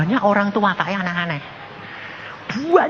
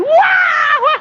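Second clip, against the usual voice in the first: loud, high mock bawling; hammed-up crying "waah"; imitated wailing; 6 dB louder than usual. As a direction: bawl comically, twice as hard. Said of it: "Nangisnya dua kali lipat".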